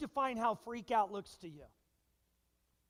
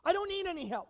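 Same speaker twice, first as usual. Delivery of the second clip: stubborn refusal; 6 semitones higher, 9 dB louder than usual. Retold or imitated. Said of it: "have you heard this one before?".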